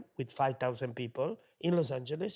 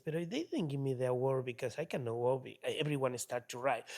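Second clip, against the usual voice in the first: ordinary talk, nothing marked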